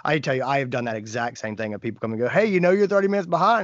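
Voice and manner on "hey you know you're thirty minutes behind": a touch higher, louder and faster nag; lightly mocked coworker. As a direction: rattle it off like a pesky reminder. Imitating coworker who points out lateness.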